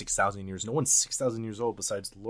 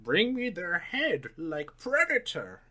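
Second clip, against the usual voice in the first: higher, slow, hammy villain demand; mock villain order; 7 semitones higher, slower than usual. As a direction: drag it out like a cheesy evil boss. Villain quote riff.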